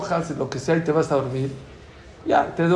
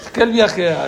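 laughing; 4 semitones higher, 3 dB louder than usual. Real, not acted.

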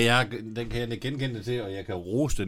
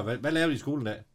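lightly voiced parent, higher and quick; a hint of an eager parent, asking briskly; parent quizzing a child; 5 semitones higher, faster than usual. Parent asks children about their school day, lightly acted eager questioning.

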